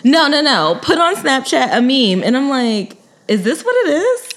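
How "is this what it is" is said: pitched way up in disbelief; incredulous. Retold or imitated. Host mocking kids who only text, incredulous. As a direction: ask it like you can't believe it. Reacting to texting-only dating.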